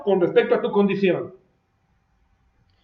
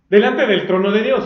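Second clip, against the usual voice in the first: ordinary delivery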